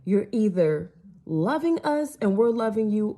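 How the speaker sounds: ordinary talk, nothing marked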